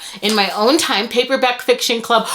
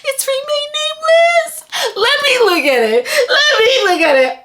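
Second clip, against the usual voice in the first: very high, tearful squealing; overjoyed squeal; 13 semitones higher than usual. Real, not acted. Reader seeing her longed-for book, tearful squeal. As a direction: squeal as if about to cry.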